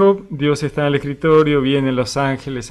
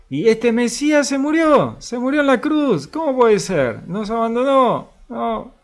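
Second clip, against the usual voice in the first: high, louder cry of lost hope; anguished disbelief; 10 semitones higher, 4 dB louder than usual. Acted. Preacher voicing disciples who lost their Messiah, anguished and loud. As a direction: pour out the disciples' despair.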